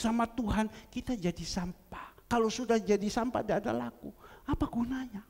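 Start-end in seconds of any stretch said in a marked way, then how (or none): 4.5-5.2 whisper. Acted. Preacher whispers the question.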